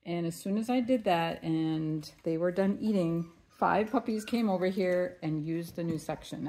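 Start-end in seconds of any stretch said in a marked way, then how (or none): none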